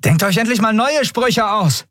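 high, exasperated yell back; shouted retort. A narrator's reading. Captain answers attackers' threats, shouted retort. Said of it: "gab Andriel zurück".

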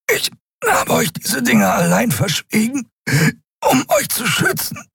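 very high, strangled gasping; struggling for air. A narrator's reading. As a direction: gasp between phrases as if choked. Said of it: "Kai rang nach Luft". (nach Luft ringend)

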